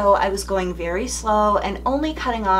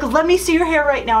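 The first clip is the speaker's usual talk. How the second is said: higher, fast, insistent demand; urgent daughter; 8 semitones higher, 5 dB louder, faster than usual. Retold or imitated. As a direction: daughter pushing to see the hair, quick high urgent demand. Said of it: "She's like".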